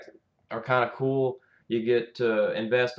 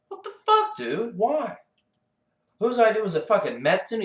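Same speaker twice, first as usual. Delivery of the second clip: much higher, disbelieving shout; incredulous outburst; 9 semitones higher, 3 dB louder than usual. Real, not acted. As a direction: demand who came up with this.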